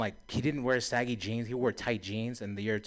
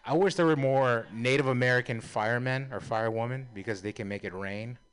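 usual speaking voice

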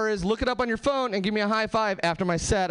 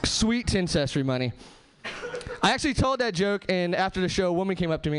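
normal talking voice

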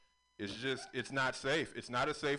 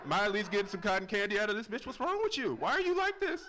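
rising exasperated pitch, higher and higher; exasperated rant; 9 semitones higher, 6 dB louder than usual. Acted. Comic ranting at the crowd, exasperated squeal. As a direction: climb into an outraged squeal.

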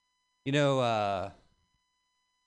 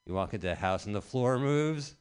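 ordinary talk

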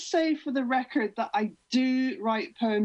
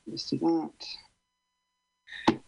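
ordinary talk

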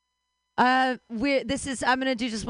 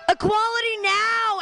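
Equality now yelled much higher and louder; rally the crowd on Equality now; shouted slogan Equality now; 11 semitones higher, 4 dB louder than usual. Acted. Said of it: "rally chant".

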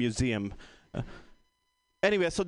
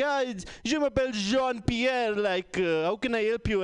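higher-pitched mock French accent; 6 semitones higher than usual. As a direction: play a French phone agent with a French accent. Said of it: "a French guy would pick up the phone".

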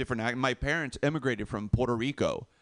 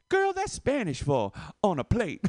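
higher, confident woman's voice; imitated girl answering; 6 semitones higher than usual. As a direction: answer a girlfriend without doubt, pitched up. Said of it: "the other girl, without missing a beat".

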